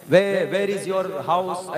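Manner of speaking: "Where, where is your house?" said very slowly in plain Indian English; simple Indian English. Shown as a demonstration. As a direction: spell it out, very slow Indian English. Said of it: "Indian अंग्रेजी हम लोग simple बोलते हैं".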